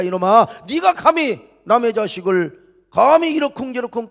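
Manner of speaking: loud, high-pitched scolding of Satan; preacher acting out God's indignant telling-off; God's angry rebuke. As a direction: thunder a rebuke, defending your own. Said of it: "사탄이를 책망하고 있는 거야".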